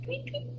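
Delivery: high, soft birdsong tweets; bird tweet. Pretend. Bird imitation again.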